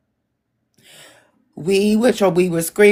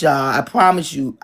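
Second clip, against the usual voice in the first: ordinary delivery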